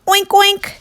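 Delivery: high squeaky oink; high piggy grunt. Acted. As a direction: oink like a little pig. Turtle giving back oinks.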